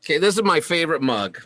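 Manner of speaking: eager proud show-and-tell. Real, not acted. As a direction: present it like a prized treasure. Shows off beloved mug.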